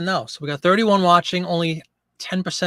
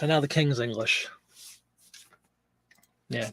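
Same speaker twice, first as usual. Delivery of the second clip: usual speaking voice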